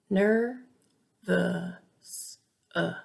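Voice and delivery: nervous broken into ner, vuh, s, then uh; syllable-by-syllable schwa. Shown as a demonstration. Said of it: "It makes the uh sound".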